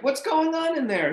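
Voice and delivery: high excited call; calling from another room. Retold or imitated. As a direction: shout curiously from next door. Dane calling from other room.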